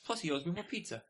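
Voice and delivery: ordinary delivery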